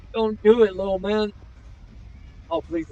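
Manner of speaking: high, loud pleading cry; pleading shout. Real, not acted. Streamer yelling at the cat in the clip, pleading. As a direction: beg him not to, yelling at the screen.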